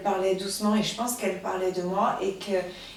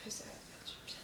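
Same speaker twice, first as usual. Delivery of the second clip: whispered; 23 dB quieter than usual. Acted. Unvoiced aside.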